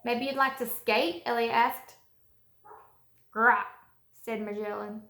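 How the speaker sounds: put-on storybook read-aloud for little kids; reading to children. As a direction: read it like story time for a class. Teacher reads picture book aloud to kids.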